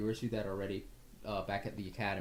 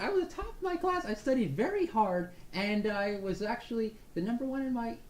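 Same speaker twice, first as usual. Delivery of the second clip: boastful brag; 10 semitones higher than usual. Acted. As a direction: puff up about top marks. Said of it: "Ferdinand is like".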